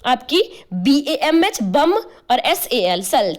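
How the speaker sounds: बीएएमएच बम and एसएएल सल dictated slowly; slow dictation: बीएएमएच बम, एसएएल सल; slow letter spelling. Shown as a demonstration. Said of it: "कौन कौन सी?".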